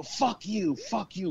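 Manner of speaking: high-pitched mock cursing; cursing demons. Demons curse the wizard, acted.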